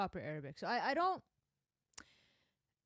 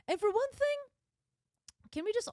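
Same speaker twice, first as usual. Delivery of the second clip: much higher and louder; heated outburst; 13 semitones higher, 6 dB louder, faster than usual. Real, not acted. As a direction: launch into the point with force. Starting a rant.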